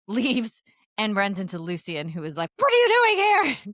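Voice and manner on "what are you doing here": mock angry shout. Lucian catching her out, retold.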